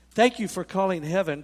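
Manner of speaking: loud, booming voice of heaven; nice big voice. Shown as a demonstration. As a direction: announce it big and grand. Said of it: "it'd be a nice big voice".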